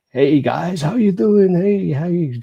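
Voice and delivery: high, loud boisterous greeting; hammed-up drunk bloke hailing them; big German guy. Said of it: "big fucking German guy goes".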